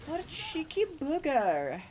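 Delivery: high, slow playful taunt; cheeky taunt voice. Pretend. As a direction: tease it in a silly voice. Taunting game enemy.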